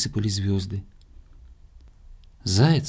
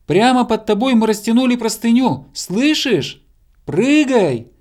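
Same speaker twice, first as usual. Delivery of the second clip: loud, high-pitched urgent instructions; bear cub shouting; 10 semitones higher, 6 dB louder than usual. A narrator's reading. Bear cub telling hare to jump, loud high shouting. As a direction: holler up into the darkness. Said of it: "закричал в темноту медвежонок".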